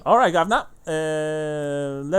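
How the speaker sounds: a chirpy lad showing off that he can read; cockney dockhand. Acted. GM voices young dockhand again.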